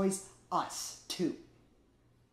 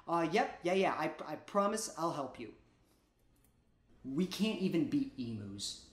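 normal talking voice

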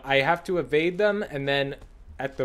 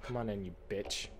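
muttered taunt; 5 semitones lower, 12 dB quieter than usual. Real, not acted.

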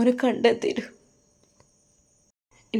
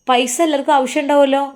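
raised sharp retort; 6 semitones higher, 5 dB louder than usual. Acted. Wife argues over money.

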